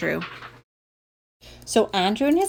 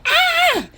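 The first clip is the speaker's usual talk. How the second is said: very high "aah" shriek; sudden piercing yelp; horrified yell. Acted. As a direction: shriek as a mortified kid. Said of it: "yelled Taylor J".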